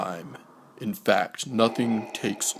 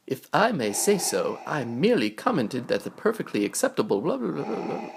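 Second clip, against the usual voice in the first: higher, fast prissy chatter; fussy droid; 6 semitones higher, much faster than usual. Acted. Protocol droid complaining before shutdown, fast and prissy.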